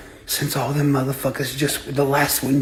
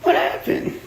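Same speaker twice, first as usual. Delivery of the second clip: higher, teasing retold taunt; mock call-out; 9 semitones higher than usual. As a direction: call out to them with a mocking lilt. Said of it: "I said".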